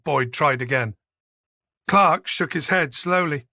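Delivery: usual speaking voice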